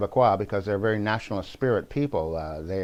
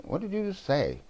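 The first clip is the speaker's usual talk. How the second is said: higher, softly puzzled mock question; a hint of bemused imitation; puzzled Macron; 8 semitones higher, 5 dB quieter than usual. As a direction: lightly imitate a baffled, polite listener. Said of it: "Macron looks at him like".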